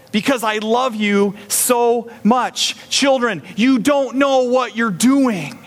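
high, urgent loving father calling out; Jesus pleading. Acted. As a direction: plead hard with children you love. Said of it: "Jesus coming into the temple saying".

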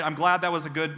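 ordinary delivery

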